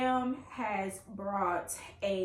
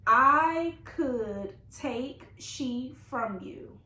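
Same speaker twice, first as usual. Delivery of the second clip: very slow, louder, word-by-word quote; drawn-out repeat; 2 semitones higher, 6 dB louder, much slower than usual. Retold or imitated. Reader repeats the mom's odd question, drawn out loudly. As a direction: stretch every word in disbelief at the wording.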